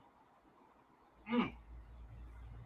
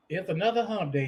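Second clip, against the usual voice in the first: ordinary talk, nothing marked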